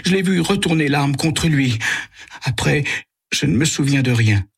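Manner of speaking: high, shaken and halting; a frightened man recalling the gun in a high, shaken voice; trembling. Acted. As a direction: tell it still in shock and fear. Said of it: "Il parle en tremblant".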